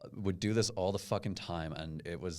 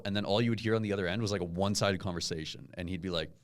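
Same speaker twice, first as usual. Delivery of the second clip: usual speaking voice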